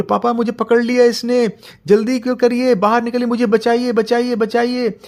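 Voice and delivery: high, loud screaming for rescue; child seized by the monster, crying out; child being taken. Acted. Child begs papa to save him, screaming.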